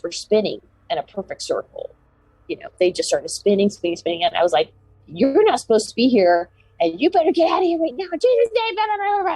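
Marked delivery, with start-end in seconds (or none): 5.1-9.3 higher and higher, a furious yell climbing in pitch; retold angry scream. Shouting at the lights to drive them away, rising yell. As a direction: scream it off the sky, building in fury. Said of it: "I started screaming at this thing".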